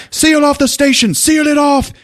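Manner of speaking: high, urgent bellow; mock alarmed police command; shouted order. Chief ordering the station sealed, urgent shout.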